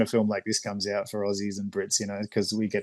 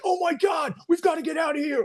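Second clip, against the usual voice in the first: very high-pitched mock panic; hammed-up American movie hero in a frenzy; over-the-top American. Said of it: "American comedians are really, really over the top".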